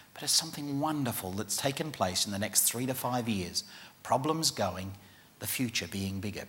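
guided meditation. Acted. Speaker guiding conference audience through a meditation, soft and low. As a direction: talk them gently into a calm daydream.

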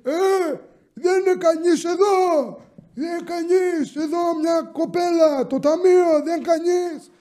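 high-pitched mock yelling of an old customer; yelling grandpa. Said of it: "αρχίζει και ουρλιάζει και λέει".

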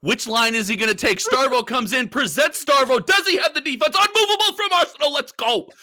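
rising, hyped play-by-play call; esports caster. Pretend. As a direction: call the match like a frantic caster. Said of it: "shoutcast your own game".

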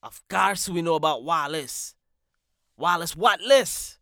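loud teasing jab; loud mocking. Real, not acted. Host teasing Wallace loudly, mock outrage.

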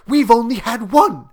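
high, loud, urgent; eager, feverish insistence; rapid reply. A narrator's reading. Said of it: "she replied rapidly".

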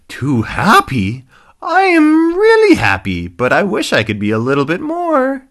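high, loud, over-happy hippo voice; Lucky. Acted. Happy hippo answering grumpy rhino, squeaky gleeful voice. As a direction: gush at the top of your register. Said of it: "You sound a little bit too happy".

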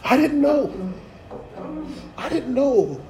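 high, mock-innocent soap-opera gasp; hammed-up daytime drama innocence; soap opera denial. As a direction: play the wide-eyed soap star caught out.

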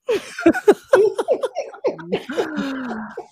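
high laugh, running on; peals of laughter. Real, not acted. Friends hearing "they have moved on now", high peals of laughter.

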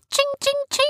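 very high ringing "ching"; imitated glass clinks. A narrator's reading. Narrator imitates paper hitting rum glasses, high clinking. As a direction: mimic glasses pinging in a high pitch.

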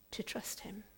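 hushed whisper of trust; soft unvoiced whisper. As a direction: breathe the words almost silently. Whispered repetition.

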